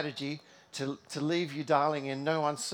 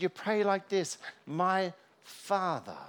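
slow reverent teaching; 6 semitones higher, slower than usual. Acted. Jesus teaching how to pray.

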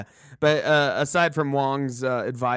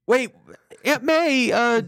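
pleading Peter; 10 semitones higher than usual. Retold or imitated. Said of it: "Peter is like".

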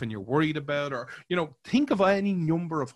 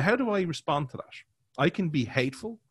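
ordinary delivery

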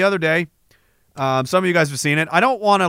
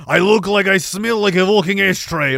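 Zelensky impression. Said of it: "Remember that Zelensky video? Where he was".

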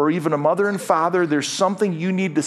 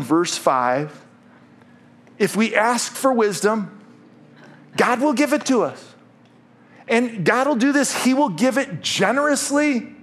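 higher, earnest pastoral appeal; altar call; 6 semitones higher than usual. Acted. Pastor to bowed congregation, earnest and raised. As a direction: invite the congregation gently to pray. Leads closing prayer moment.

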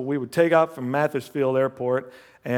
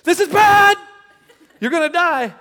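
much higher, louder alarm; alarmed inner voice; 9 dB louder than usual. Retold or imitated. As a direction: let the panic shout. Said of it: "every feeling in me was telling me".

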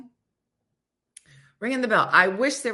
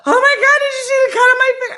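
shrill, loud, fast hammed-up panic over nothing; mock a panicky person freaking out, laughing; much higher and louder mock panic; panicky overreaction; 11 dB louder, much faster than usual. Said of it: "panicky people".

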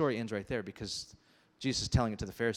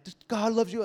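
hype man; 10 semitones higher, 6 dB louder than usual. Retold or imitated. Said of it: "I'm like".

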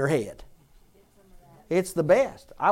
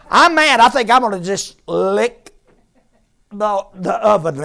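mock angry rant; 3 semitones higher, 8 dB louder than usual. Preacher mocking someone who hurts themselves when mad, hammed-up loud anger. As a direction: ham up a petulant tantrum.

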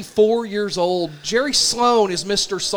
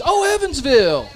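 mocking, higher and louder jeer; mock taunt, pitched up and loud; 5 semitones higher, 4 dB louder than usual. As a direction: sneer at the town name like it's a joke. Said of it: "taunting me at this point".

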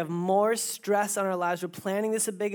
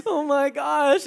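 mock tearful wail, higher and drawn out; imitated ugly cry; 6 semitones higher, 7 dB louder, much slower than usual. Fiancée mid-proposal in front of everyone, imitated ugly cry. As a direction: overcome with happy tears, hammed up loudly. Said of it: "the ugly cry".

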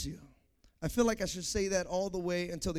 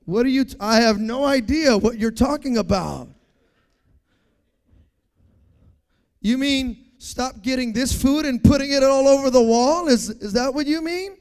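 loud, exaggerated clueless questioning; mock innocence; 3 semitones higher, 11 dB louder than usual. People answering a request to stop, mock innocence. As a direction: play dumb loudly, stretching it into a question.

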